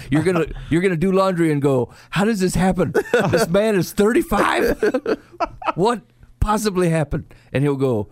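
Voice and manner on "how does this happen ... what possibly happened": high-pitched, drawn-out disbelief, breaking into laughter; mock incredulity. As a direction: play the baffled spouse, laughing through it. Imagined spouse finding dirty underwear.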